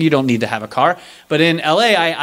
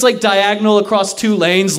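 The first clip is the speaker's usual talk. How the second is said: ordinary talk, nothing marked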